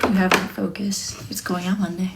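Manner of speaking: breathy whisper, no voice. Real, not acted. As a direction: whisper it close and intimate. Talking softly to her work.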